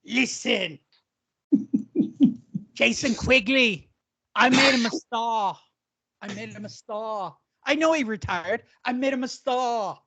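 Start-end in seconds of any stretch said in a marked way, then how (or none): 0.1-7.4 mock English accent. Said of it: "I wish I could do an English accent". 8.8-10.0 higher-pitched fake English boast; mock English accent. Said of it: "I wish I could do an English accent".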